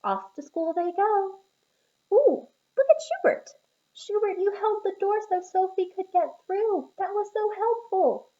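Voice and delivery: high, loud, delighted cheering; excited praise. A narrator's reading. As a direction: cheer the little helper on. Narrator cheering Schubert on.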